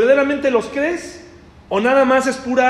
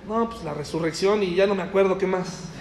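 softer, lower, embarrassed reply; a student who forgot the lesson; sheepish mumbled answer; 4 semitones lower, 7 dB quieter than usual. Retold or imitated. Martha to Jesus, softly fumbling.

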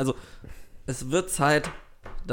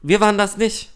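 mock defiant denial; 7 semitones higher, 6 dB louder than usual. Mock beef with rival podcast.

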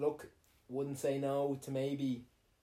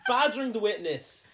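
mock lawyer; 6 semitones higher, 6 dB louder than usual.

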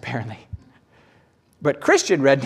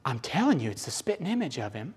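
soft acted angel confiding; hushed insistence; 8 dB quieter, faster than usual. One angel convincing another, hushed insistence. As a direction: insist under your breath to a fellow angel. Angels whispering among themselves.

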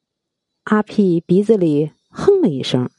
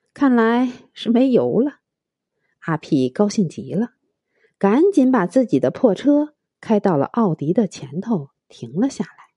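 usual speaking voice

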